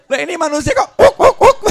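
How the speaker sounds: high, loud barked woof; dog barking. Acted. Preacher imitating a dog, loud barking. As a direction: bark like a dog.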